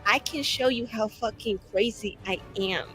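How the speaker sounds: usual speaking voice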